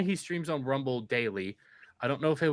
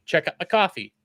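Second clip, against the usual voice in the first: casual plug; 4 semitones higher, 4 dB louder than usual. Retold or imitated. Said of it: "you'd be like".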